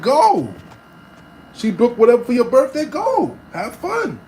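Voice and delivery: high, loud push to go; telling his friend to just go, loudly; emphatic go. Retold or imitated. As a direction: urge him on loudly. Insisting friend go.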